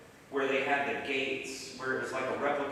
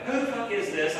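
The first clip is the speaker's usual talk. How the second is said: higher-pitched, irritated retort; ticked off; 6 semitones higher, 3 dB louder than usual. Retold or imitated. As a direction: re-enact snapping at an unknown caller. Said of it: "kinda ticked off with this dude".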